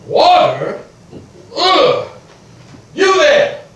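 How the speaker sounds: loud, high shouts for the servant; calling out. Acted. Creditor shouting again for the servant, loud. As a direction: yell for service.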